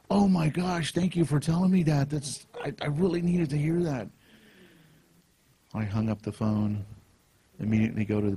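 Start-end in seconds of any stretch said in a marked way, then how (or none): 0.1-4.1 imitated grateful woman, much higher pitch; overcome with gratitude. Woman thanking a caller for the message, gushingly grateful. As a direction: lift the voice high, gushing thanks.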